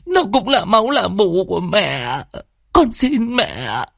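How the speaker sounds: high, plaintive pleading; weeping daughter imploring her mother; tearful begging. Acted. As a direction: beg through tears, stretching the last plea. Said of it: "khóc lóc van xin".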